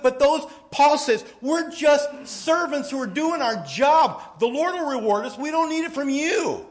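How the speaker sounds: high, loud preaching to the congregation; impassioned preaching. Acted. Pastor urging his congregation, high and loud. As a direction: drive the point home from the pulpit.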